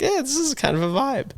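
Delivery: high-pitched stoned voice. Retold or imitated. Retold high reaction to weed.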